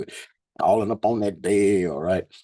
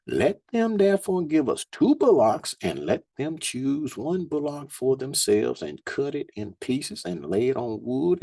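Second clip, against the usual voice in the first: scripture reading; 5 semitones higher than usual. Acted. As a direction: lift the verses up like a preacher. Reads scripture aloud.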